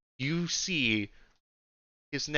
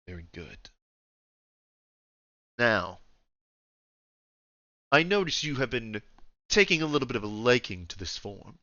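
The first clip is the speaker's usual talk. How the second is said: lightly voiced old ruler; understated, measured imperial command; a hint of a deep, dominant voice; refined old Emperor; 3 dB quieter than usual. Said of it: "deep voice that".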